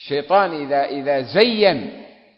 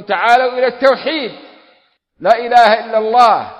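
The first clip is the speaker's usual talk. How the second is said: calling out; 6 semitones higher, 7 dB louder than usual. Acted. Preacher calls people to tawhid.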